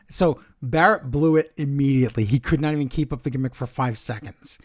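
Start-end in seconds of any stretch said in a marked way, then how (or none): none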